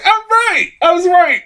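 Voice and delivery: high, gleeful I was right; triumphant. Retold or imitated. Guessing the movie twist early, gleeful and triumphant. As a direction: crow with delight at guessing it.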